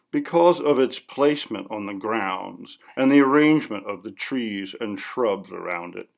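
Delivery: ordinary delivery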